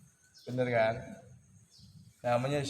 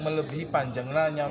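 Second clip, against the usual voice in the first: ordinary talk, nothing marked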